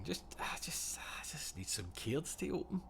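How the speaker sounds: breathless whisper, longing for cards; whispered plea. Real, not acted. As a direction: whisper it like a craving. Mock-desperate aside about cards.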